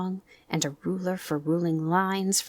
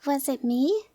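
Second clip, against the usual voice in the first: small, higher voice, mildly puzzled; little surprised; 8 semitones higher than usual. Acted. Roo asks Kanga, a little surprised. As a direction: Roo wondering if he spoke out of turn. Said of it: "a little surprised".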